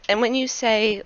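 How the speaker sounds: usual speaking voice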